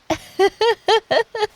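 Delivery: a high, amused teasing laugh. Real, not acted. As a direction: burst out laughing at him.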